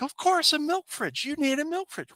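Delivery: high, exasperated mock wife voice; hammed-up married-couple argument; mock bickering wife. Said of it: "start arguing like an old married couple".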